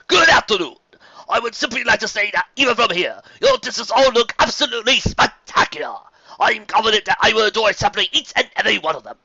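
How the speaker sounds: high, loud, courtly welcome; gracious nobleman. A narrator's reading. Fancy Pants greeting the contestants, loud and courtly. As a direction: address the crowd like a genteel aristocrat.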